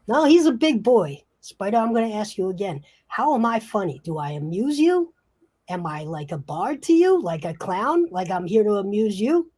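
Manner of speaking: offended wiseguy demanding an answer; menacing Branzino. Acted. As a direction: press each question as a challenge.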